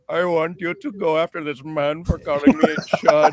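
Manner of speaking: put-on light Indian accent, quick. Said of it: "so I just did an Indian voice".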